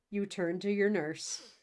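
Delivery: ordinary delivery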